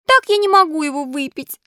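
high-pitched whimpering protest; whining puppet. A narrator's reading. Puppet refusing bitter medicine from fairy, whining. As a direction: whine it out, pitched well up. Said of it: "захныкал поэт с ужасными гримасами".

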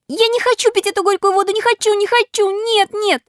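high, fast puppet wailing; tearful tantrum. A narrator's reading. Puppet refusing bitter water from fairy, tearful tantrum. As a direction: protest frantically in a high-pitched voice. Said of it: "заливаясь слезами".

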